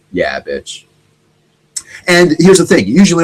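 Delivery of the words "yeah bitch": lower, softer smug gloat under the breath; smug muttered gloat. Retold or imitated. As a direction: quietly savor the win. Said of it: "I'm like".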